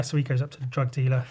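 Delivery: ordinary talk, nothing marked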